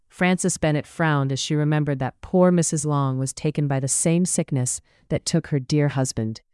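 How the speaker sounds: ordinary delivery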